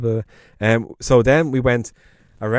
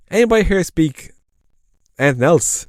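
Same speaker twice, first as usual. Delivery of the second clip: teasing host, pitched up; 7 semitones higher than usual. Retold or imitated.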